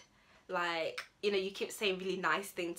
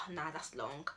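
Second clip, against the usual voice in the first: dismissive; 4 semitones lower, 6 dB quieter than usual. Acted. Turning down a party invite.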